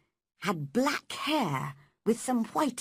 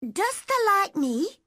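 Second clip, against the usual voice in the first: high, hopeful Yorkshire dialect question; 12 semitones higher, 5 dB louder than usual. Acted. Mary asks Dickon in Yorkshire dialect if he likes her. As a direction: ask it shyly in broad Yorkshire dialect. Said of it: "asked him a question in Yorkshire dialect".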